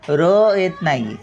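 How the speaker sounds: usual speaking voice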